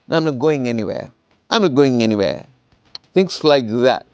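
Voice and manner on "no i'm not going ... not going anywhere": falling, settled tune ending on "anywhere"; falling answer intonation. Shown as a demonstration. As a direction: send "anywhere" down to close the answer. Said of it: "sending your things down".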